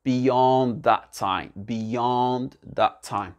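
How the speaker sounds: very slow, "beyond" drawn out on its second syllable; slow repeat-after-me model. Shown as a demonstration. Teacher modelling a phrase to repeat, very slow. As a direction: stretch each word so learners can copy. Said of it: "bien répéter après moi".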